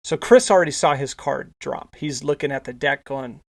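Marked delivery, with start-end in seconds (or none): none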